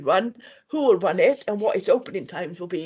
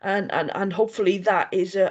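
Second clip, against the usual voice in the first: ordinary delivery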